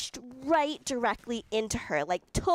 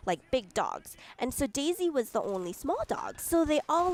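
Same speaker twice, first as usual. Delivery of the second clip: normal talking voice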